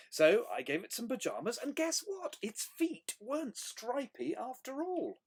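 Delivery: high, bouncy storytelling voice; animated picture-book reading; story read to children. A narrator's reading. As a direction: read it with big swoops for young listeners.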